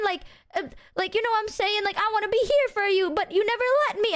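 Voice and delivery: high-pitched, worked-up hurt friend impression; imitated mounting anger. Friend asking to be trusted, imitated getting angrier and emotional. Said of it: "she gets angrier over time".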